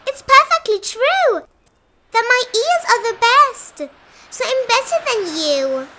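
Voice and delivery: loud, high-pitched triumphant rabbit; bragging. A narrator's reading. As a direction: crow the victory up high. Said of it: "bragged the rabbit".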